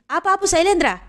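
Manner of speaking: high-pitched call to someone nearby. Acted. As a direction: call out to get him to stop. Calling to stop an auto. (పిలుస్తున్నట్టు హెచ్చు స్వరం)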